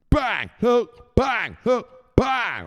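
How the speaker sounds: high, loud rhythmic shouts; shouted punch noises. Pretend. Miming blows at his guest, loud high rhythmic shouts. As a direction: shout each word like a landed punch.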